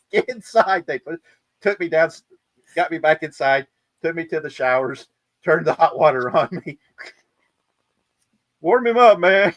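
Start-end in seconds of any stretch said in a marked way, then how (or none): 0.1-0.9 high, loud order to come in; urgent yell. Retold or imitated. Shipmates yelling at the soaked sailor to get inside, loud and high. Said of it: "They're like". 8.6-9.5 high, loud call to warm him up; loud order. Retold or imitated. Shipmates calling to warm the sailor up, loud and high. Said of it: "shipmates ordering the shower".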